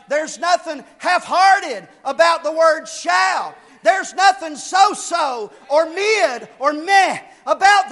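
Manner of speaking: loud, punched pulpit delivery; insistent preacher driving a point home; emphatic preaching. Real, not acted. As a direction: hammer each phrase, landing heavily on "shall". Preaches on the word shall.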